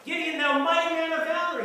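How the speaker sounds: a lightly voiced God calling out to Gideon; God's greeting. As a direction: hail him as God would. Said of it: "That's how he greets him".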